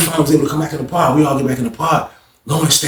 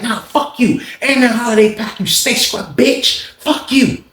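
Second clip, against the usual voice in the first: drawn-out pitched-up cussing; high, slow mock insult; 7 semitones higher, slower than usual. [name] insulting Lawrence, high and slow. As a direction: spit it slowly, pitched high.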